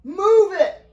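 loud, high-pitched command; urgent shout. A narrator's reading. Father orders the children out, urgent shout. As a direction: shout it to hurry them along. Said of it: "he shouted".

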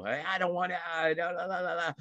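soft singsong la la la, ignoring it; softly teasing a child covering its ears; mock avoidance. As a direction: hum the thoughts away like a child plugging ears. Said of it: "just let 'em go through".

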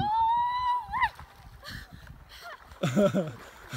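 shrieking laugh after cold water; high laughing shout. Real, not acted.

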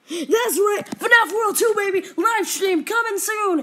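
high, loud hype yell; excited shout. Real, not acted. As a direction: shout it like a big reveal.

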